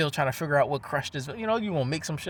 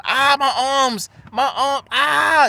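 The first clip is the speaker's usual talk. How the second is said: high, loud wail of sudden agony; hammed-up yelps of pain, much higher and louder; acted pain cries; 10 semitones higher, 8 dB louder, much slower than usual. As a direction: cry out as if your arms are being crushed. Acts out body being crushed.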